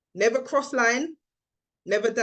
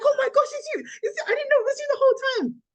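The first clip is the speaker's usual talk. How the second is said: very high-pitched imitated gushing; retold fan squeal, high and breakneck at the end; exploding excitement; faster than usual. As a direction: squeal in amazed recognition, rushing the end. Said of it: "persona just exploded".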